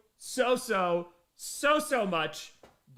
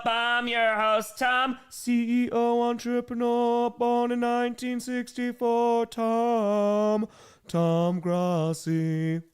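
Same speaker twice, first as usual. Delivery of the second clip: announcer voice; 2 semitones higher, 5 dB louder, much slower than usual. Acted. Podcast host intro.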